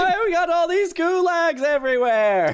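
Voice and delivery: shrieking mock comedian rant; mock whiny rant. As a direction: whine like a stand-up bit.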